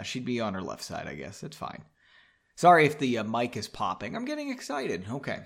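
ordinary delivery, nothing marked

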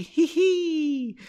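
very high mock giggle; squeaky, put-on "hee hee"; light laugh. A narrator's reading. Narrator voicing Henry laughing lightly, high fake giggle. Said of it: "laughing lightly".

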